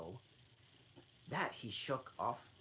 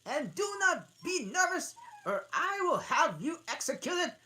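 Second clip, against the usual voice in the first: high, loud, commanding king; shrill king voice; 11 dB louder than usual. Acted. King demanding evidence from the witness, high and loud. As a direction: bark it down from the throne. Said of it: "said the king".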